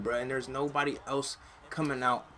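normal talking voice

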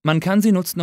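ordinary talk

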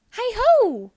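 high, loud hare hail; cheery call. Acted. Hare calling hello loudly from the door. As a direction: call out a bright greeting from the door.

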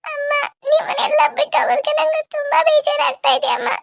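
high-pitched crying voice, breaking into sobs; tearful sobbing. Acted. Bride telling her family she hates leaving, sobbing. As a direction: cry the words out with sobbing gaps. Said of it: "ಯಾಕೆ ಮಧು ಇಷ್ಟೊಂದು ಅಳ್ತಾ ಇದೀಯಾ".

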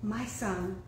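ordinary delivery

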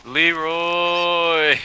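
shouted high Leroy; battle cry. Real, not acted. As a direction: yell it like a charge. Friend cheers the risky jump.

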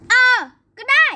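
loud, high snapped "fine then"; angry shout. Acted. Nanny shouting back at the child in a loud, high huff.

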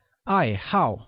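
both syllables of 爱好 falling in pitch; falling tones. Shown as a demonstration. Teacher demonstrates the word for hobby, falling tone on each syllable of 爱好. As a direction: say 爱好 with a sharp fall on each syllable. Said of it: "pronounced with the falling tone on both A's".

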